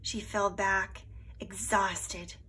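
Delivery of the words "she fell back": usual speaking voice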